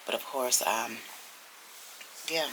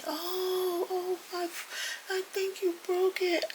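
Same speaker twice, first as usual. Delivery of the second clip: high mock sob of pain; imitating a crying man; slower than usual. Neighbor crying after his foot was run over, high sob. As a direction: cry it out like a hurt old man. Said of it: "he's crying".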